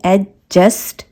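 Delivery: "Adjust" stretched slowly, the d and j both heard; very slow "adjust", d then j; slow spelled-out demo. Shown as a demonstration. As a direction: drag "adjust" out so each sound is heard. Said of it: "दो sound आते हैं".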